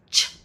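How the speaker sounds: "ch" as a short burst; CH sound. Shown as a demonstration. Teacher showing an accented C to learners, CH sound. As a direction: say "ch" as in C H, no vowel. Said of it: "like C H".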